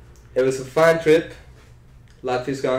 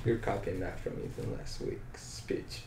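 slow, soft whispered jab; whispered aside; 9 semitones lower, 18 dB quieter, slower than usual. Real, not acted. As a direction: mutter it under your breath, slowly. Teasing aside under his breath.

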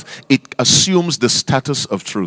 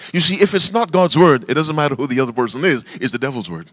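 rushed, fast and fading softer; too quickly; 5 dB quieter, much faster than usual. Shown as a demonstration. Preacher rushes his point to the congregation, fast and fading. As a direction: hurry through it, swallowing the end. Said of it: "I said that too quickly".

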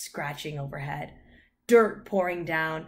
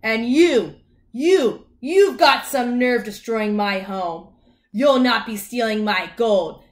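loud, raised-pitch outrage; indignant leprechaun yelling; angry shouting; 5 semitones higher, 11 dB louder, slower than usual. A narrator's reading. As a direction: shout down the intruder wrecking your home. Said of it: "I shouted".

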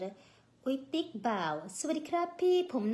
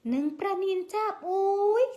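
startled cry; 9 semitones higher, 7 dB louder, slower than usual. Real, not acted. Startled exclamation while reading messages.

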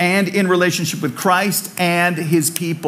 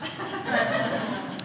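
soft breathy laugh; 6 semitones higher, 12 dB quieter than usual. Real, not acted. Laughs at own joke.

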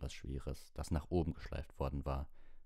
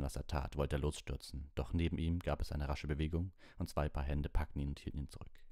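plain reading voice

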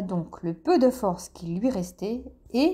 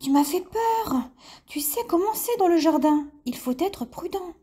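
high, timid little voice; a startled lizard peeking out; 7 semitones higher than usual. A narrator's reading. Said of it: "une petite tête effarouchée qui disait".

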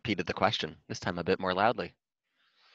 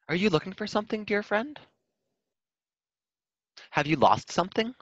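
much higher, friendly giraffe voice; giraffe from above; 13 semitones higher, 3 dB louder than usual. Acted. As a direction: call down kindly from up high.

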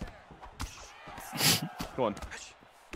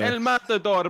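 raised, higher call; catchphrase call; 5 semitones higher, 5 dB louder, slower than usual. Pretend. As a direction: crow the fighter nickname. Calls out catchphrase in match.

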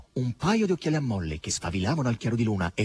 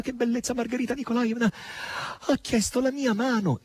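high, bubbly confession; bubbling over with joy; high giddy maid voice; 10 semitones higher than usual. A narrator's reading. Natascia giddily confessing to mistress.

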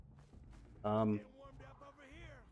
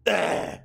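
pained yelp; 7 semitones higher, 8 dB louder than usual. Real, not acted. Pain screams in combat.

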